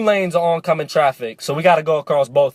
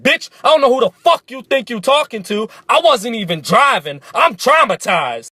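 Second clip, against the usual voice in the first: raised, heated retort; flipping out; 5 semitones higher than usual. Retold or imitated. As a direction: snap back at the cop, outraged. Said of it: "I start to flip out".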